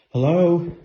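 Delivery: loud wary call into the house. Real, not acted. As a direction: call out into the dark, uneasy. Calling out in dark house.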